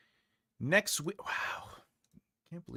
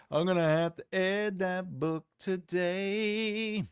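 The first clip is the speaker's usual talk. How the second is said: slow sing-song announcement; drawn-out repeat; 5 semitones higher, slower than usual. Pretend. Streamer telling himself to add that book, drawn out. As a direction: stretch every word slowly, holding the last.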